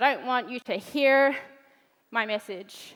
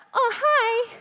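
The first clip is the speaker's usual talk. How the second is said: innocent dad; 9 semitones higher than usual. Retold or imitated. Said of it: "He'd be like".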